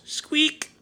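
very high little squeak; mouse squeak. A narrator's reading. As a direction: answer as a tiny mouse would. Narrator imitates the mouse.

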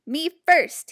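loud eager cry; cried out. A narrator's reading. Pushy pig crying out at story time. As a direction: demand to be first. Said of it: "he cried at story time".

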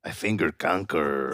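mock guilty mumble; low sheepish admission. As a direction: own up to it quietly.

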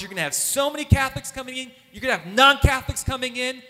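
building to a loud call; excited prophecy. Retold or imitated. Prophet announcing the youth ministry's growth, building up. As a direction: proclaim it with rising fervour.

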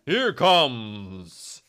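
slow, drawn-out ring announcer call; boxing ring announcer. Pretend. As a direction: stretch every word like a prize fight intro. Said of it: "wie eine Boxansage".

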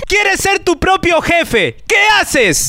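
hammed-up infomercial host; infomercial pitch. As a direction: sell it like a cheesy ad. Mock ad pitch.

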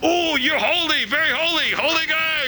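high, slow, syrupy flattery; mock adulation. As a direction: gush over the holy guy. Mocking flatterers.

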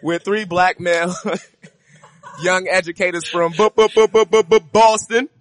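stammering while laughing loud; can't get the word out for laughing; laughing intro. Real, not acted.

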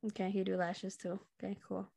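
quiet, dismissive mock reply; unimpressed. Stranger hearing it's just lashes, quietly unimpressed. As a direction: brush it off under your breath.

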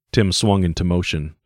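plain reading voice

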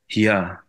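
"here" said as "hee-ah"; "here" with an open "ah" ending; West Scottish "here". Shown as a demonstration. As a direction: model a loose Scottish "here". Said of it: "you'd say here".

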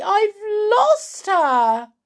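high, slow, loud wail; frantic high-pitched woman's voice; alarmed cry. Acted. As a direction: cry out in panic, stretching every word. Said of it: "cried Missus Cranky".

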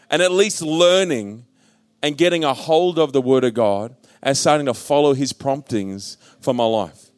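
ordinary delivery

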